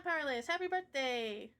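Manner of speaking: ordinary delivery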